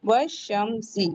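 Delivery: वशमसी drawn out, w joined straight to sh; slow demo. Shown as a demonstration. Teacher to students, slowly modelling वशमसी. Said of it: "नहीं पढ़ेंगे".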